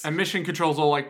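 normal talking voice

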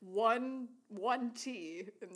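very slow, dragging each word in disbelief; drawn out. Real, not acted. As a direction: stretch it out, unimpressed. Mocking the tiny meal.

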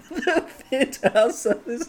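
high-pitched giggling through the words; can't get the line out for laughing; laughing hard. Real, not acted. Cracking up over his own bad penthouse joke, laughing.

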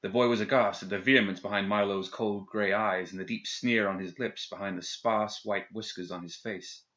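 plain reading voice

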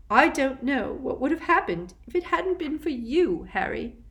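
muffled, face pressed in a hug. Acted. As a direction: speak muffled against someone's shoulder. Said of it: "she said in a muffled voice".

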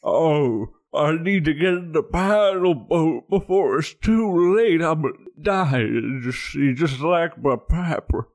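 hammed-up dying old man; put-on old-man voice. Old man begging for the paddle boat, hammed-up dying voice. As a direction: wail feebly as if dying of age. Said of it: "did you get old all of a sudden?".

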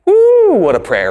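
high, loud and slow woo of wonder; excited whoop. Real, not acted. Preacher to the congregation, an excited awed whoop. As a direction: marvel out loud at the prayer.

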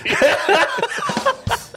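high-pitched burst of laughter. Real, not acted. Big laugh.

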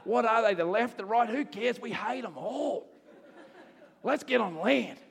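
high-pitched, hammed-up Aussie mates egging on. Fish's mates ranting about government, hammed-up Aussie. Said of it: "all of his Aussie mates are like".

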